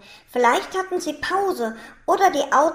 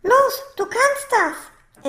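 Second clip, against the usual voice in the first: high, slow, encouraging call; drawn-out, high-pitched child's encouragement; child cheering papa on; 9 semitones higher, 4 dB louder, slower than usual. A narrator's reading. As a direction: urge papa on, stretching the words. Child urging papa to climb.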